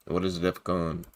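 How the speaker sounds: low, soft echo of the question; quiet repeat. Real, not acted. Host repeats the question while looking it up, quiet and low. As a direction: murmur the question over to yourself.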